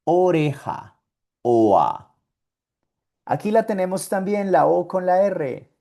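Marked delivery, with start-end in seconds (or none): 1.4-2.1 oa as German Ohr. Shown as a demonstration. Teacher modeling German Ohr. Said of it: "Como esta palabra, oa".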